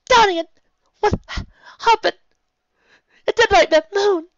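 wailing, high and loud; high crying wail. Acted. Luna crying out to sister.

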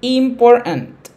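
IMPORTANT slow and loud, falling; teacher stressing Important for students; emphatic stress. Shown as a demonstration. Teacher stressing the key word slowly. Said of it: "Esto es crucial".

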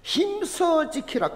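힘써 drawn out slowly and pitched high; stress on 힘써. Shown as a demonstration. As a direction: lean hard and slow on 힘써, then 지키라. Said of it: "그냥 지키라 안 했잖아요". (힘써를 아주 느리고 높게 강조)

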